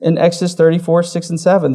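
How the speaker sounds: normal talking voice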